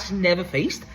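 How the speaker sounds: ordinary talk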